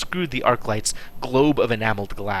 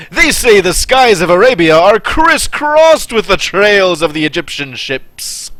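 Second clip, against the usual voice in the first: booming exhibitor; 7 semitones higher, 9 dB louder than usual. Acted. Exhibitor voice continues.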